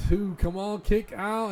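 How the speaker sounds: high, urgent plea; pleading. Real, not acted. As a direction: beg him to kick out. Urging wrestler to kick out.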